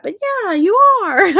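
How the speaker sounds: high laughing reply. Real, not acted. Laughing at her realization.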